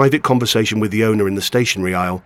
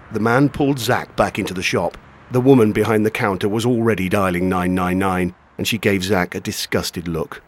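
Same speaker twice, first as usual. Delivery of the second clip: usual speaking voice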